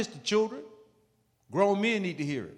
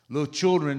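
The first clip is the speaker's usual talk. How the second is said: normal talking voice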